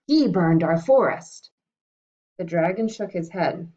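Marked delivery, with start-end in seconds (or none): 0.1-1.5 loud accusing cry; king crying out. Acted. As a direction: blame the dragon out loud. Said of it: "he cried".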